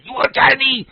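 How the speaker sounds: squeaky imitation of the delivery man; mock Chinese accent. Delivery man checking the order, in a high mock Chinese accent. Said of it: "hilarious to me".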